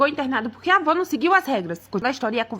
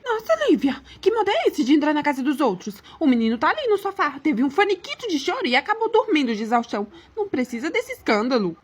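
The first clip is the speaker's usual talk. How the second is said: indignant mother-in-law. Acted. Mother-in-law scolds wife for barging in, indignant. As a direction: scold her in a raised pitch.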